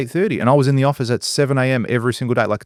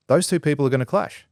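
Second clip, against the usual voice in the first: usual speaking voice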